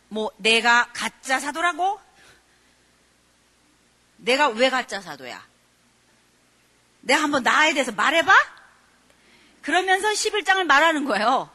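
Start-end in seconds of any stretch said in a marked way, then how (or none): 0.1-8.3 indignant apostle. Shown as a demonstration. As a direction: read it as a wounded apostle pushing back. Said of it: "여러분 이걸 그렇게 읽어야 돼요".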